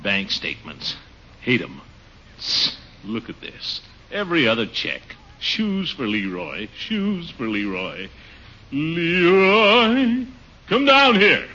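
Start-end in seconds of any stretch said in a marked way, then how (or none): 0.0-8.1 grumbling to himself. Acted. Grumbling over bills.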